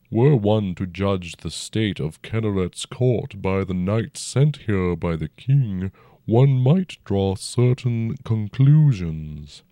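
even, unhurried dragon voice; calm and level, an old dragon. A narrator's reading. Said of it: "Its voice stayed even".

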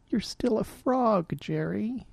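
a hint of tearful despair; miserable. A narrator's reading. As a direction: say it with defeated sadness. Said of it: "I said miserably".

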